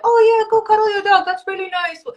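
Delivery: high, sweet mock-gentle voice; soft indulgent coach. Coach mocking the soft answer she could have given, high sugary coo. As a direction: coo it like an overly nice coach. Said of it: "I could have been like".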